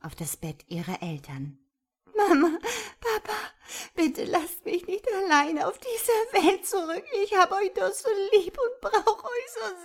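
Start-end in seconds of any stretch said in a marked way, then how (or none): 2.2-2.6 "Mama" called out much higher and louder, pleading; a girl's loud, high pleading "Mama" to her dead mother; pleading cry. A narrator's reading. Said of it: "flehte sie weinend". 3.0-9.8 high, loud tearful begging; girl sobbing to her dead parents, higher and louder; pleading while crying. A narrator's reading. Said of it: "flehte sie weinend".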